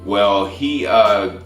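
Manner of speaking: usual speaking voice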